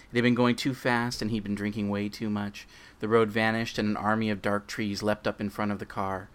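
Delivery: normal talking voice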